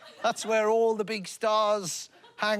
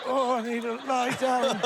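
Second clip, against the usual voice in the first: lightly voiced weary old man, pitched up; hint of an old fella worn out; mock old man; 5 semitones higher than usual. Old rocker needing a lie-down, lightly voiced old man. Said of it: "but he's like".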